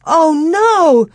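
high, loud wail of protest; anguished cry. A narrator's reading. Girl hearing the heifer calf will be killed, anguished cry. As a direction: cry out in horrified refusal. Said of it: "Laura cried".

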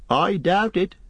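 high-voiced, unhappy innkeeper; unhappy landlord. A narrator's reading. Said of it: "said the landlord unhappily".

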